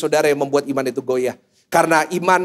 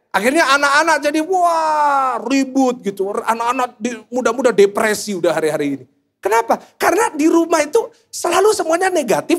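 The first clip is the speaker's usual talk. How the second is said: preaching at full force; 7 semitones higher than usual. Acted. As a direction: drive it home to the crowd. (khotbah berapi-api, suara tinggi)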